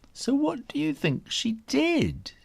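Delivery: put-on high, playful question to the kids; teasing question. A narrator's reading. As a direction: tease them with it. Asks the young listeners.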